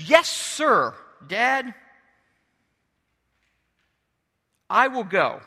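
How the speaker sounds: lightly hammed-up eager son; enthusiastic. Second son promising his dad to go, enthusiastic. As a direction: punch out a willing, obedient yes. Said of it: "This son is so enthusiastic".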